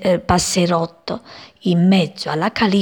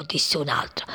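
ordinary delivery

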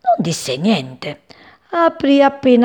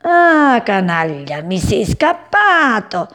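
loud, slow angry outburst; cat howling at the bird that got away; furious cat yell; 2 semitones higher, 4 dB louder, slower than usual. Acted. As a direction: spit it out in rage at the escaped bird. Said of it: "urlò il gatto infuriato".

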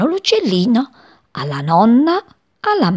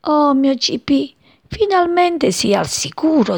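relieved mother bird; 8 semitones higher than usual. A narrator's reading.

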